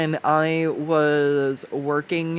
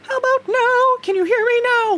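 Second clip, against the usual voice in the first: high falsetto uncle, a bit louder; 6 dB louder than usual. Retold or imitated. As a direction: squeak it up like SpongeBob. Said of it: "starts talking in a falsetto".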